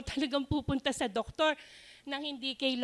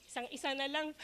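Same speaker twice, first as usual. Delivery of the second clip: ordinary talk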